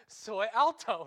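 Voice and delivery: Soy alto called out loud with a laugh behind it; loud joking Spanish. Shown as a demonstration. Preacher joking about his height, loud playful call. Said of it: "laughs so".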